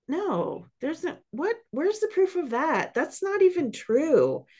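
high-pitched, incredulous mock protest; incredulous self-challenge. Catching herself in negative self-talk, incredulous protest. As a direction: challenge yourself in a high, disbelieving voice.

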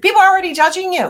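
fast, high, loud blast of the line; shouted truth. Real, not acted. Coach shouting the hard truth at her listeners, loud and fast. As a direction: hit them with it full force.

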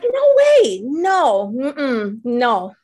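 mock horror; refusing. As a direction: shoot it down in shock. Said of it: "I'm like".

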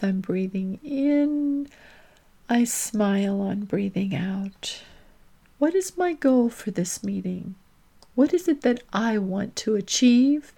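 hushed calm meditation prompts; guided breathing meditation. Acted. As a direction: lead them gently into the still space.

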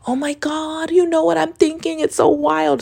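high, breathless excited gushing. Retold or imitated. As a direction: gush like a giddy girl. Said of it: "I was like".

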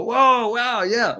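high, amazed gasp of wonder; impressed audience. Retold or imitated. Audience at his talks reacting, high amazed wow. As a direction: act out the crowd being blown away. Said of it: "People go".

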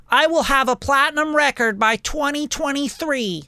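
high, mock-proud put-on voice; mocking naive ambition. Mocking a young artist's goal sheet, high put-on voice. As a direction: read the naive goal like a joke. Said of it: "a goal sheet that says".

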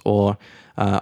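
ordinary talk, nothing marked

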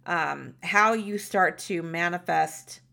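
ordinary talk, nothing marked

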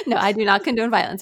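loud, fast, laughing retraction; laughing quick backpedal. Real, not acted. Walking back her joke to the host, fast and laughing.

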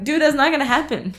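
high, blunt, fast reply. Retold or imitated. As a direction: shut it down bluntly. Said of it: "I'm like".

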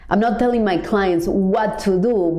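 ordinary delivery

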